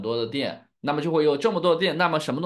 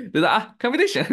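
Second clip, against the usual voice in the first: quick, high word breaking into a laugh; amused laughter; 11 semitones higher, 5 dB louder, much faster than usual. Real, not acted. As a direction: giggle through the tricky word. Laughing after tricky word. (边笑边说)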